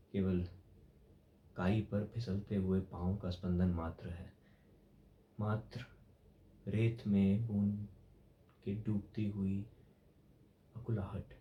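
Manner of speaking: low, soft, halting reflection; hushed brooding. Acted. Musing on a drop sinking in sand, hushed brooding. Brooding on life's fleeting flutter.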